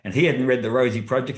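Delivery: ordinary talk, nothing marked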